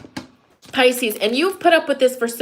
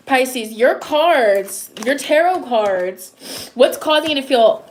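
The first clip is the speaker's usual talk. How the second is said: ordinary delivery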